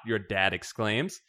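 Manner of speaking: usual speaking voice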